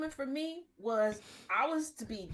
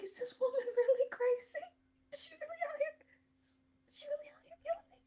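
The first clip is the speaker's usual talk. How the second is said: whispered panic, pitched high; scared whisper. Retold or imitated. Teller inwardly panicking beside the killer, frightened whisper. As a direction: hiss it in rising alarm. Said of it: "And I'm like".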